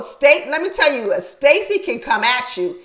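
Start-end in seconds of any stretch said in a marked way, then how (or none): none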